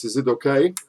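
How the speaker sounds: normal talking voice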